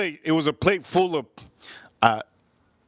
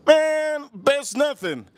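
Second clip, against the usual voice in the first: high, dismissive comeback; acted annoyance; exasperated retort; 10 semitones higher, much slower than usual. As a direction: dismiss it in a raised pitch. Kid rejects the father's word.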